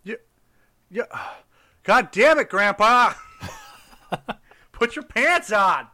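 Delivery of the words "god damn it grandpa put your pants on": exasperated shout. Pretend. Scolding grandpa in a bit.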